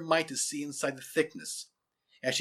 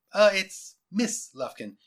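higher, slow, polite interruption on "Miss Lufkin"; smiling correction; 6 semitones higher, 4 dB louder, slower than usual. A narrator's reading. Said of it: "she interrupted with a smile".

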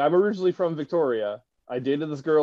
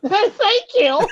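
put-on much higher, louder squealy girly greeting; high-pitched girly voice; 6 dB louder than usual. Retelling a comedian's drive-thru skit, a high-pitched girly voice. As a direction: squeal it like an overexcited girl. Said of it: "really high-pitched girly voice".

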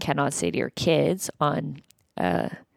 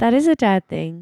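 put-on child voice; 4 semitones higher than usual. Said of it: "I'm going to play the child".

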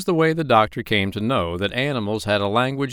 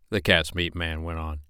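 plain reading voice

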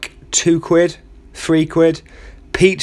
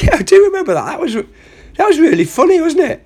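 much higher, laughing through the words; giggling soldier; 14 semitones higher than usual. Acted. Soldier recalls the comedian's bit to his mate, giggling high. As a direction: giggle as you remember the joke.

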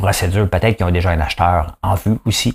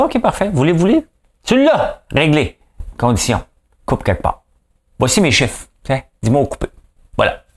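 premier ministre joué, voix nettement plus aiguë; François Legault; 8 semitones higher than usual. Pretend. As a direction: play a premier handing over demands, pitched well up. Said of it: "Moi, je suis François Legault. Je dis". (imitation du premier ministre, voix haut perchée)

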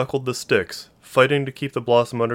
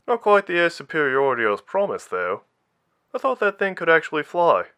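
higher, lightly voiced ribbing officer; teasing commander voice; 7 semitones higher than usual. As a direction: tease her a touch. Carradin teasing over the comm.

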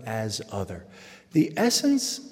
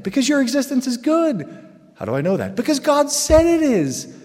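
fervent proclaim; 12 semitones higher, 7 dB louder than usual. Real, not acted. Preaching climax.

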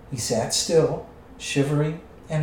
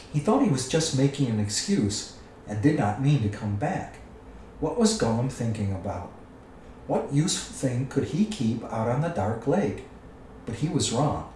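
ordinary delivery, nothing marked

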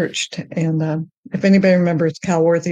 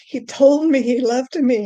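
ordinary delivery

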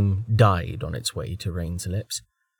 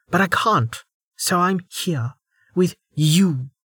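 higher, seething woman; bitter anger; 7 semitones higher than usual. Acted. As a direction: spit out her hatred. Velika's bitter tirade.

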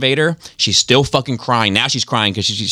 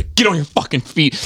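mock [name] impression; 7 semitones higher than usual. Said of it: "with the [name] thing".

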